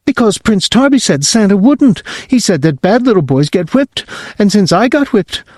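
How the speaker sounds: put-on high child's voice, pleading; little boy. Whipping boy asking his parents if he is bad, high child voice. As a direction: squeak it out like a worried small boy. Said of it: "Whipping boy".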